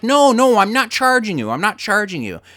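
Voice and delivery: calm the man with the gun, frantically; panicked backing down. Retold or imitated. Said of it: "And he's like".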